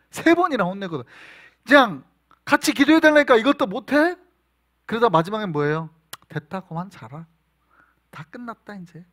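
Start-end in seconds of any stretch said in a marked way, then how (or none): 1.7-4.2 loud, high exasperated rebuke; exasperated scolding. Acted. Jesus scolding sleeping disciples. 6.3-9.0 quiet, dry sarcastic dismissal. Acted. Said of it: "약간 sarcastic하게".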